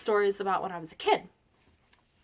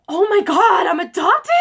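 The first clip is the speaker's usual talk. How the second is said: loud, high mock-horrified outcry; hammed-up shock, voice shooting up; 7 semitones higher, 8 dB louder than usual. Girl hearing mom confirm she's adopted, dramatically shocked. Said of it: "I was, like, shocked".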